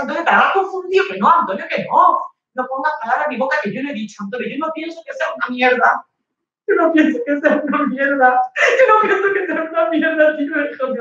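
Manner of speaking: high fast shouted denial; heated shouting. Acted. Girlfriend denying at Antonio, heated shout.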